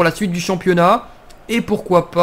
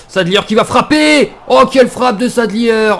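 excited; 5 semitones higher, 4 dB louder than usual. Real, not acted. Goal chance in FIFA career.

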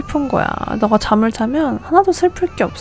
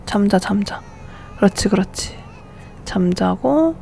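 soothing hush; 3 semitones lower, 7 dB quieter than usual. Real, not acted. Soothing sims babies to sleep.

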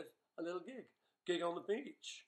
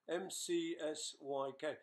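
ordinary talk

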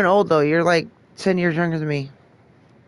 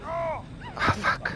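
panicked whispered cursing; being chased; 9 semitones higher, 15 dB quieter, slower than usual. Real, not acted. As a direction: hush it while hiding. Killer right nearby, hiding.

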